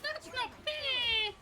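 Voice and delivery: high, slow, drawn-out whine; long wail of mock injustice; whiny protest. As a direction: protest like a sulking child, pitched high. Complaining about the game.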